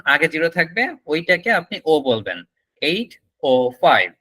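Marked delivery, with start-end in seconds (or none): 2.8-4.1 very slow, separated words; demonstrated time phrase. Shown as a demonstration. Teacher showing students how to say it, slow model. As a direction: draw out "eight oh five" word by word. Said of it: "এরকম, এরকম ভাবে".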